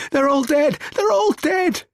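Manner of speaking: very high, loud, anguished cries; shouting. A narrator's reading. Joseph finds his family shot, shouting. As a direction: shout it out in horror. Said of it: "Joseph's shouting".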